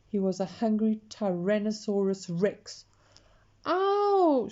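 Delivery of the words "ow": short, high, loud shriek of pain. A narrator's reading. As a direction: Peter shrieks as he gets squashed. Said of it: "shrieked Peter".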